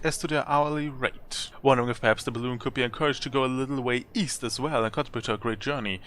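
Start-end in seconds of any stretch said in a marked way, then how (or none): none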